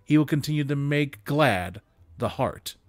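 ordinary delivery, nothing marked

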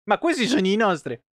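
high, sing-song mock of the companion; mocking a game character. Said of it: "lui fa". (imitazione acuta e canzonatoria del compagno)

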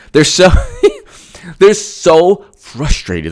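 laughing through, slowed. Real, not acted. Host laughing at frustrated opponents. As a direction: gloat with a chuckle.

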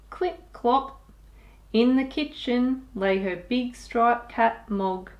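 ordinary delivery

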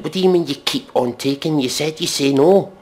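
usual speaking voice

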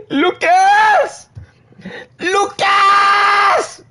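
high, loud yell of the name; yelling full name. Real, not acted. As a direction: shout his name at full volume.

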